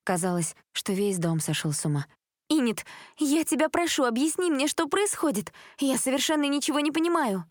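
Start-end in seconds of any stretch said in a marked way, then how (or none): 2.5-7.4 high, pleading, close to tears; crying on the phone. A narrator's reading. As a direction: beg her friend on the verge of tears. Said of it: "Элизабет чуть не плакала в трубку". (чуть не плача в трубку)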